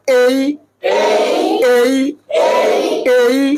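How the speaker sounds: "এই" as a long gliding ei; model pronunciation of A. Shown as a demonstration. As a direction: draw "এই" out into a clear diphthong, ey. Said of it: "এ উচ্চারণ ভুল। আমার সাথে বলো".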